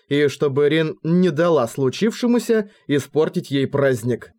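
ordinary delivery

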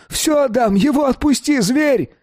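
high, squealing surrender; desperate cry. A narrator's reading. Dealer gives in to save son, desperate cry. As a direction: plead frantically in a squeaky voice. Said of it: "закричал Рублёвка".

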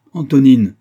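loud, falling call of her name; cry out. A narrator's reading. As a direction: fling her name out in anguish. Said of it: "cria Dournoff".

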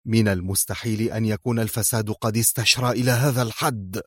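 ordinary delivery

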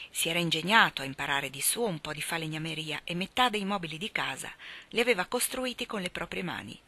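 plain reading voice

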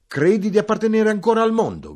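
very high, loud challenging question; shouted. A narrator's reading.

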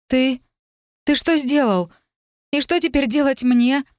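high-pitched, aghast questions; high, distressed indignation; shocked outrage. A narrator's reading. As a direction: demand answers, appalled. Outraged at loss of crystals.

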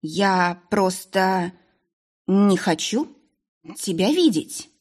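slow, each word of "Я просто не хочу тебя видеть" pressed apart; stressing every word. Acted. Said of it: "которое я старательно выделяла интонацией".

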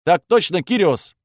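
loud, high chorus reply; shouted in unison. A narrator's reading. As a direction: snap back like cadets to an officer. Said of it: "хором ответили все".

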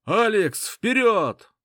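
high, loud shout from the stands; crowd yelling. Acted. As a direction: cheer him on from the stands. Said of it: "кричали с трибун".

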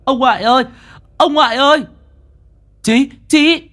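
put-on high, loud child's cry for grandpa. Grandson screaming for his fallen grandfather, high and loud. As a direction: shriek it like a panicked little boy. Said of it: "nó kêu lên".

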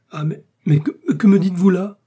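incredulous, flustered prisoner; stunned stammer. A narrator's reading. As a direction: stumble on the first words, then exclaim. Bonacieux stunned by the accusation.

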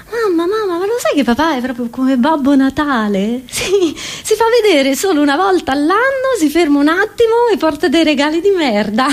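high, excited imitation of little kids; small children's voices. Mother quoting her small children about their father, mock childlike voice. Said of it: "quando erano piccoli mi dicevano".

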